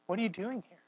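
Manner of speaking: God questioning Elijah at the mountain, soft; God asking softly. Retold or imitated. God asking Elijah why he fled, softly voiced. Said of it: "It asks".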